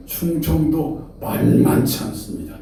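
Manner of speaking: ordinary talk, nothing marked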